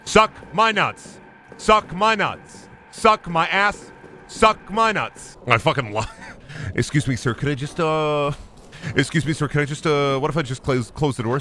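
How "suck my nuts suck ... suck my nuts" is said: higher, slow, drawn-out jeer; slow taunt. Real, not acted. Gamer goading the monster, slow drawn-out jeer. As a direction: stretch each word to goad him.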